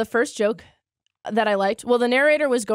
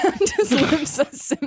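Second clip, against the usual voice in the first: laughing; 7 semitones higher than usual. Real, not acted. Laughing at her own nickname.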